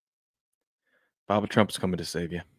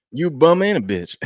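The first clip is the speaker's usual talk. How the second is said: higher, louder mock trash talk at Brooks; 7 semitones higher, 6 dB louder than usual. Host acting Butler taunting Dillon Brooks, higher and louder. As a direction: spit it like a taunting player. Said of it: "Saying that to Dillon Brooks".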